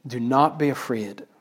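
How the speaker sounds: soft, connected phrase at normal pace; soft repeated quote. Acted. Preacher repeating Jesus's words to the congregation, softly. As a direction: repeat it quietly as one phrase. Repeats the verse more simply.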